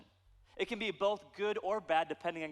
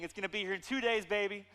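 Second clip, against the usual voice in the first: quick, higher-pitched shopper's excitement; giddy anticipation; 5 semitones higher, faster than usual. Acted. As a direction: rush it out, acting thrilled. Acts out giddy online shopper.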